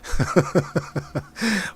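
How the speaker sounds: real burst of high chuckles; laughing at an irritated post; amused chuckling. Real, not acted. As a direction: chuckle in a quick, pulsing run.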